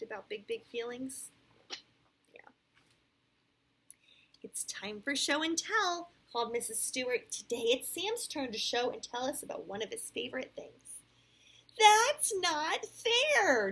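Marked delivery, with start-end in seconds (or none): 4.4-11.0 clear, animated read-aloud; storybook voice with lively rises and falls; picture-book narration. A narrator's reading. Parent reads Sam's story to a child, animated storytelling. 11.8-13.7 loud, high, dragged-out whine; pouting. A narrator's reading. Jenny protests that it's Sam's turn, pouting loudly. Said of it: "Jenny pouted".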